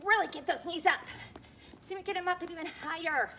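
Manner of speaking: loud coaching push. Real, not acted. Pushing the final set.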